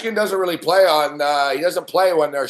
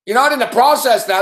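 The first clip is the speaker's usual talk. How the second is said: "not" hit hard in a higher, louder scoffing retort; angry throwback; 5 semitones higher, 4 dB louder than usual. Real, not acted. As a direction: throw his words back at him in scorn. Angry mocking throwback of quote.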